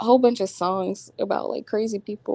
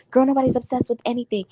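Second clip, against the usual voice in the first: quick, higher mimic of the girl; mocking the girl; 4 semitones higher, faster than usual. Imitating the girl blowing up her phone, quick mimic. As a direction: rattle it off like the nagging girl.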